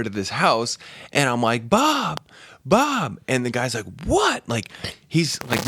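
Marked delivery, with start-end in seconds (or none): 1.7-3.2 drawn-out, high-pitched calling of a name; mock yell. Stranger at the open door calling for the husband, mock yelling. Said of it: "yell for Bob". 4.1-4.5 very high-pitched, incredulous cry; startled retort. Retold or imitated. Man answering a stranger at his door, startled.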